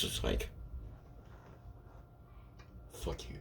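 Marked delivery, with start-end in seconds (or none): 2.9-3.4 barely audible mouthed insult; mouthed silently. Retold or imitated. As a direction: mouth it without voice. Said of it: "Pretty much mouths at".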